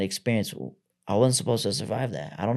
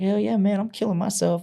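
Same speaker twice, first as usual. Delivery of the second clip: high, upbeat delivery of a dark joke; breezy dark-humor reply among army buddies; mock cheerful; 9 semitones higher than usual. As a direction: say it like great weekend plans.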